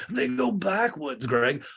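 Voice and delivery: high, drawn-out put-on voice; Lisa. Lisa defends them to Greg, high slow imitation.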